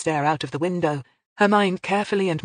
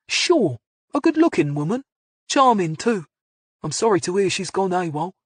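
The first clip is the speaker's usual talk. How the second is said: Cockney man on the phone, a little louder; faint Cockney accent; 3 semitones higher, 4 dB louder than usual. A narrator's reading. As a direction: answer with traces of Cockney. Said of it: "last vestiges of a lingering Cockney accent".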